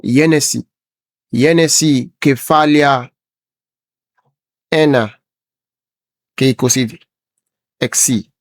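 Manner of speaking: careful Greek reading of Yenesi and Kefalia; Greek pronunciation. Shown as a demonstration. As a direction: read Genesis one twenty-six the Greek way, Yenesi, Kefalia, Ena. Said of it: "the Greek accent and everything".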